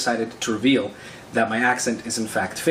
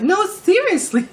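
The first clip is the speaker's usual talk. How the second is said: very high, drawn-out mock-girl protest; loud, high, slow imitation of her; imitated insistent girl; 6 dB louder, slower than usual. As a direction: insist slowly in a hammed-up girl's voice.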